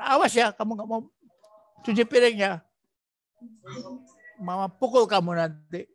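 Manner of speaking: a hint of a scolding mother; mild mock warning to a child; mock threat. Shown as a demonstration. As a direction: threaten lightly, half-acted.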